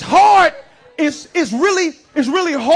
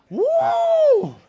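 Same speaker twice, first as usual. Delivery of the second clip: high falsetto woo cry; a preacher's high whoop. Real, not acted. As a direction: let out a high excited woo. Exclamation, no real words.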